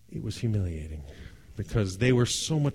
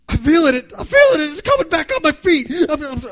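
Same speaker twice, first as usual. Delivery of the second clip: high, loud ecstatic cry; overwhelmed excitement; 10 dB louder than usual. Retold or imitated. Father moved by the drum class, crying out high and loud. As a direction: burst out as the drums fill you up. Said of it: "I'm feeling like".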